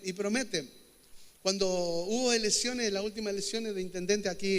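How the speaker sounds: ordinary talk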